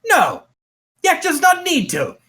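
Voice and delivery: defensive goblin boss, jumping high in pitch; Yek. Acted. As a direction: refuse like a proud, stubborn goblin king. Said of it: "Yek is going to look at you and go".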